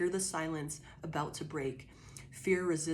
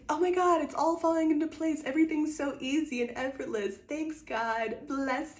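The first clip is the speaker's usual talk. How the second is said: high, bright mock thanks that fades down, dripping irony; mock gratitude; 9 semitones higher than usual. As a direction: gush sarcastically, then wind down. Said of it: "Like, it's".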